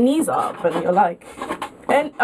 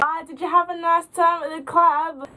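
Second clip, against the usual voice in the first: high-pitched mock-friendly taunt; sing-song tipsy heckle, imitated; tipsy friend heckling; 7 semitones higher than usual. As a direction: call out tauntingly like a drunk friend. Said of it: "she was just like".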